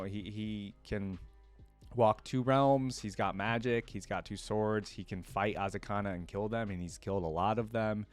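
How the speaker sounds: ordinary talk, nothing marked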